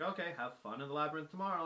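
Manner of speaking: quick, breezy sarcasm as Ariadne; sarcastic Ariadne. Retold or imitated. Ariadne brushing off Theseus, fast and sarcastic. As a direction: toss it off fast, sarcastically wishing him luck. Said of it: "she's like".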